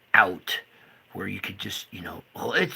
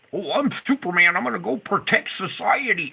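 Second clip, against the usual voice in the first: higher, louder puffed-up hero voice; mock the cop's swagger; 8 semitones higher, 5 dB louder than usual. Mocking police officers, puffed-up hero voice. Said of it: "chip on your shoulder".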